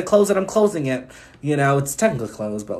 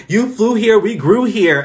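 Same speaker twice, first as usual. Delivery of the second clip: mock New York attitude, punchy and loud; hammed-up New Yorker brag; girl on TikTok faking a New York accent; fake New York accent; 6 semitones higher, 6 dB louder, slower than usual. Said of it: "fake New York accent".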